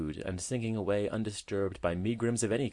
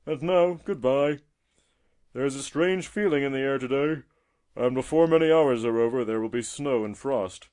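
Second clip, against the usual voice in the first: loud, raised, hearty tortoise voice; friendly old tortoise; 5 semitones higher, 7 dB louder than usual. A narrator's reading. Tortoise warning the robin of snow, hearty farewell. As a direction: bid a kindly, foreboding farewell.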